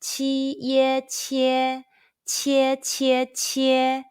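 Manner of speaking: "Qi ye qie, qie qie qie" very slow, high and level; drawn-out first-tone drill on "qie". Shown as a demonstration. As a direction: hold every syllable of "Qi ye qie" and "qie" on one high note. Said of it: "high pitch first tone all together".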